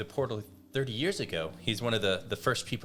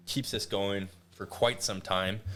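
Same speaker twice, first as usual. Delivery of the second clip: ordinary talk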